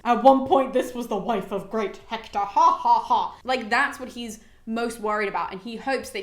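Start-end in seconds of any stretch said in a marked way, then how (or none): 0.0-2.4 loud, taunting mock of Hector's widow; mocking jeer. Crowd jeering at Andromache, loud mock taunt. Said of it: "laughing at her, saying". 2.4-3.4 loud gloating ha ha ha; mock laughter. Crowd laughing at Hector's wife, loud mocking ha-ha. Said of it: "laughing at her".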